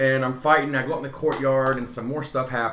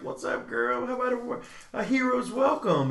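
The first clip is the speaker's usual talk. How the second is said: high, hammed-up smooth-talker line; mock suave pickup line, pitched way up; cheesy flirt; 11 semitones higher, 3 dB quieter than usual. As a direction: play the cocky hero hitting on her. Hamming up own hero flirting.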